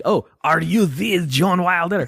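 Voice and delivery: slow, high starstruck French question; a Frenchman gushing at a celebrity; French accent, starstruck. Pretend. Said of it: "he just met Gene Wilder".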